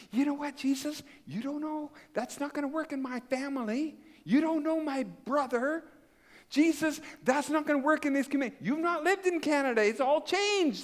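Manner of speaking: high, whiny arguing; mock excuses to Jesus. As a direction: argue back with high, pleading excuses. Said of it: "we say".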